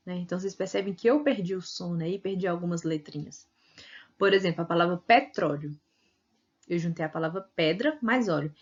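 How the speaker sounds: ordinary talk, nothing marked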